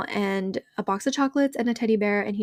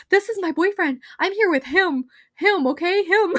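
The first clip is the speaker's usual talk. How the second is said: high-pitched, insistent mock panic; playful panic; 9 semitones higher, 3 dB louder than usual. Girlfriend retelling how she pointed out her boyfriend, high mock panic. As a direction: insist frantically, hamming up the fluster. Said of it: "I went into panic mode".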